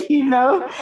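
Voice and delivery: talking through a high laugh. Real, not acted. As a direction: laugh the words out. Laughing at her level manner.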